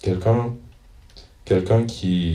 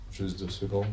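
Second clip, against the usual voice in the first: quiet aside; 12 dB quieter than usual. Real, not acted. Cherche encore dans le livre.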